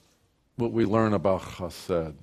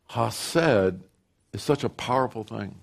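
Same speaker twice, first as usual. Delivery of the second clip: ordinary delivery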